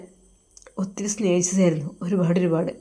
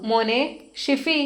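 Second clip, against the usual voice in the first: 1 mother calling; 5 semitones higher, slower than usual. Acted. Mother calls her son outside.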